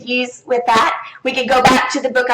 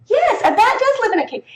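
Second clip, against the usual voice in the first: put-on much higher, laughing child reply; child's answer; 12 semitones higher than usual. Shown as a demonstration. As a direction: answer brightly like a pleased kid.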